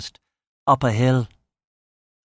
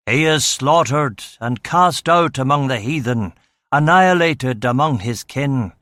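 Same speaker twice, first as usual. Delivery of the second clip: louder, rising fervent sermon; preaching; 3 semitones higher, 3 dB louder than usual. A narrator's reading. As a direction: preach it out to the congregation. Preacher heard in gospel hall.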